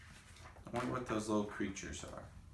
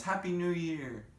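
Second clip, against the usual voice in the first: cheery greeting; 10 semitones higher than usual. Retold or imitated. Voicing villagers' greeting.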